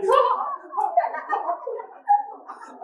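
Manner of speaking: a long, high laugh that climbs; big rising laugh. Real, not acted. Host cracking herself up on stage, high rising laugh. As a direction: burst out laughing at yourself. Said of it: "I also laugh at my own jokes".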